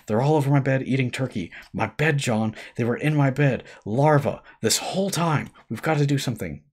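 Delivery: breathless panic telling John about the spiders; out of breath. A narrator's reading. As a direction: gasp it out after sprinting. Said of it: "I struggled to catch my breath".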